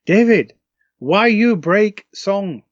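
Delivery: lightly voiced Japanese fan, high and slow; a hint of a Japanese accent, pleading. Singer imitating upset Japanese fans, a mild Japanese accent. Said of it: "the Japanese fans, you know, they were saying stuff like".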